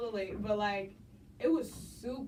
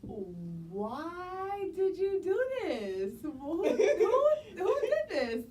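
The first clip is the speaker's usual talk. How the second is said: high, drawn-out playful wail; mock despair; 10 semitones higher, 5 dB louder, much slower than usual. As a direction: protest the challenge in a long, high whine. Mock protest at surprise challenge.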